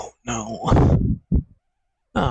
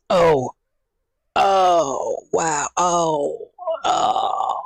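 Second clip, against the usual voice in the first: pained cry; 4 semitones higher, much slower than usual. Real, not acted. Back pain when weight goes on.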